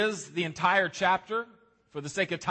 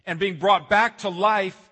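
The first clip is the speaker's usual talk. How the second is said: building preaching; 4 semitones higher, 4 dB louder than usual. Acted. Pastor driving the point home to the congregation, building intensity. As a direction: build toward the climax of the sermon.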